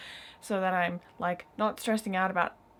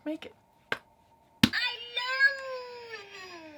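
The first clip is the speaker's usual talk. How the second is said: cooing to pet; 14 semitones higher, much slower than usual. Real, not acted. Cooing at the cat.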